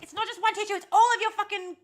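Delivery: loud, high exasperated outburst; angry rant. Real, not acted. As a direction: let the frustration explode. Ranting about tissues left around.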